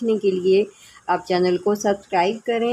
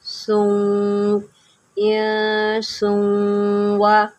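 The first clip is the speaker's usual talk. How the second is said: slow melodic Quran chanting; tajweed recitation; much slower than usual. Shown as a demonstration. Teacher models the verse for online students, slow recitation. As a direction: stretch the vowels in measured recitation.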